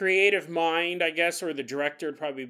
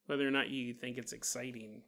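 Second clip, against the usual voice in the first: usual speaking voice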